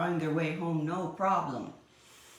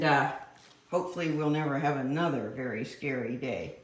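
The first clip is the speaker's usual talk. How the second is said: usual speaking voice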